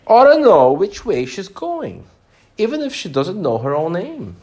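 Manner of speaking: high, loud pompous gentleman. A narrator's reading. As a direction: lecture her like a grand old man. Gentleman character voice.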